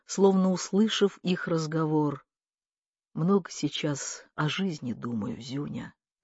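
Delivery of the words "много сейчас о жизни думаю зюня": low, soft, weary confiding; subdued. A narrator's reading. Uncle to nephew about life, quietly. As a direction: confide it low, letting it sink away.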